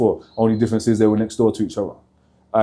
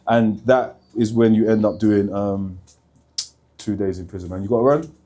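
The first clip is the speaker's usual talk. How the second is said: usual speaking voice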